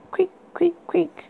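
high breathy creak sounds; whispered creaking. Acted. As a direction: whisper the bed's creak eerily. Creaking bed sound imitated.

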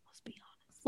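conspiratorial unvoiced whisper. Real, not acted. Host admits Gallows is the real entertainment, whispered. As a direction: tell it like a secret aside. Confessing opinion about Carl.

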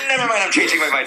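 high, fast, giggling imitation; laughing mock quote. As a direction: mimic them through laughter. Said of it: "You're like".

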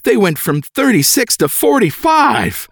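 high, drawn-out excited nurse; hammed-up excitement, stretched out. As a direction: announce it high and thrilled. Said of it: "And in an excited voice".

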